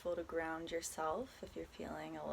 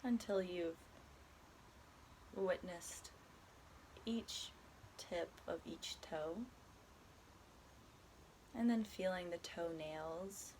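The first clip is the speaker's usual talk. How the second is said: calm, unhurried body scan guidance with long pauses; guided meditation; 4 dB quieter than usual. Acted. Guiding a listener through a body scan, soft meditation voice. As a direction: ease the listener gently from one body part to the next.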